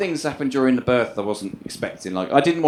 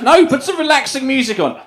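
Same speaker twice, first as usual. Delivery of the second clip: high, loud cross demand; irritated labour snap; 10 semitones higher, 7 dB louder than usual. Retold or imitated. Girlfriend in labour ordering her partner, high irritated snap.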